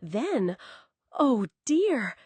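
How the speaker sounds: ordinary delivery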